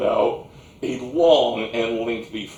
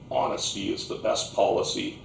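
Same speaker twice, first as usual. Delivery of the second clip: usual speaking voice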